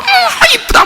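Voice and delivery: squeezed high, gushing dad; playful mock adoration; doting dad. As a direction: mimic a father cooing over his daughter. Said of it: "아빠가 막 좋아가지고".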